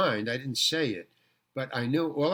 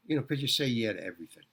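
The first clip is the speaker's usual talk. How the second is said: usual speaking voice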